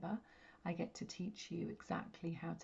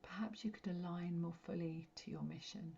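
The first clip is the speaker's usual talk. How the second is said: guided meditation. Real, not acted. Medium guiding listeners to connect with their light, meditative. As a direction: guide them gently inward, phrase by phrase, letting each settle.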